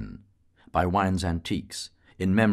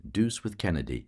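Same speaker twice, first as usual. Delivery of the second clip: ordinary delivery, nothing marked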